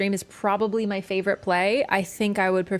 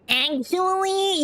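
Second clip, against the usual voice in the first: drawn-out, higher, slow "Actually"; nerdy actually; 10 semitones higher, much slower than usual. Shown as a demonstration. Showing chat how a nerd says "Actually". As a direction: stretch out "Actually" like a know-it-all. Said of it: "you don't always have to say it like that".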